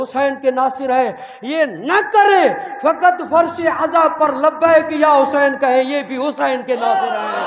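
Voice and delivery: loud, high impassioned majlis oratory; preaching at full force. Acted. Preacher rousing the mourners, loud and fervent. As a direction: build the crowd up with fervour.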